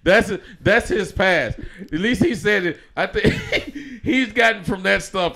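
high, loud insistence through laughter; laughing hard over the words. Real, not acted. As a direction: crack up while insisting, voice shooting up.

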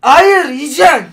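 slow, loud, firm no; loud insisting. Real, not acted. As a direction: drag it out and insist. Insisting he eat it.

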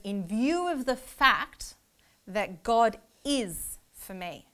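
slow, weighty reading with "God" stressed; slow verse reading. Shown as a demonstration. Teacher reading the verse aloud to students, slow and weighty. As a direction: read the verse slowly, dwelling on "God". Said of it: "This verse says".

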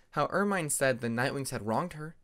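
plain reading voice

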